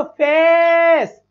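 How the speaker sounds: फेस said very slowly, vowel drawn; फेस dragged out slowly; slow demo. Shown as a demonstration. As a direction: stretch the ए in फेस. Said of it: "ए और ई लगा है".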